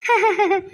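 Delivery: a short high laugh, amused; doctor laughing off the vendor's tears; light laugh. Acted. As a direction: laugh it off fondly. Doctor laughs at tearful praise.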